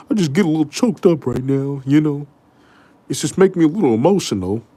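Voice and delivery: usual speaking voice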